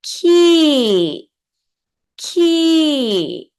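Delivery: teacher modelling the falling tone on Key; Thai falling tone. Shown as a demonstration. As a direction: let Key fall from high. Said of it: "falling tone".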